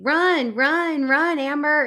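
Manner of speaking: repeated high, loud urging; screamed warning. Retold or imitated. Viewer urging Amber away from danger, screamed warning. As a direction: yell each word like an urgent plea to a screen. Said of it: "I'm screaming at the thing".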